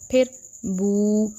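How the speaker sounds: बू drawn out very slowly, vowel stretched far too long; wrongly stretched बू; overlong vowel. Shown as a demonstration. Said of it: "इतना लंबा हमने इसको नहीं करना".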